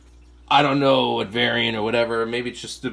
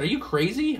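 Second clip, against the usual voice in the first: incredulous retort; 10 semitones higher than usual. Retold or imitated. Retelling a haggle with Joe.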